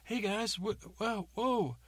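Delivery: high, flustered whoa; James calming the crowd. Acted. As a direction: hands up, trying to calm them. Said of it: "He's like".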